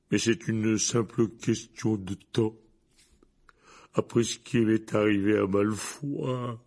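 slow, slurred, downcast Hagrid voice; a weary tipsy giant grumbling; drunk gloomy giant. A narrator's reading.

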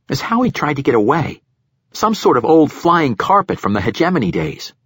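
put-on eager young lieutenant. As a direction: voice an earnest young lieutenant reporting in.

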